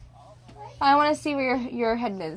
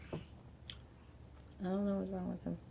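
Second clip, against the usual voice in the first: much softer, slow, trailing under her breath; quiet slow mutter; 4 semitones lower, 17 dB quieter, much slower than usual. Real, not acted. Mother venting about her partner, repeating it softly and slowly to herself. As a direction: let it sink to a slow, soft mutter to yourself.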